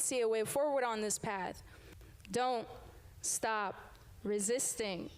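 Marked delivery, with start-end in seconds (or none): none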